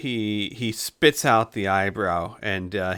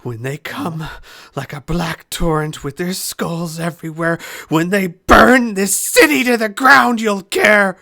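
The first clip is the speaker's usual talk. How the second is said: building to a yell; 8 semitones higher, 8 dB louder than usual. Acted. Prisoner warning Xavier of the coming army, rising shout. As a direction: let the threat grow into a roar.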